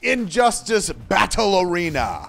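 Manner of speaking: big booming arena announcer; shouted sing-song arena welcome; announcer intro. Acted. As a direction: hype up the crowd like a ring announcer. Hosts introduce the battle show.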